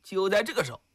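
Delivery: ordinary delivery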